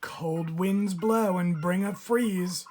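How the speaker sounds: usual speaking voice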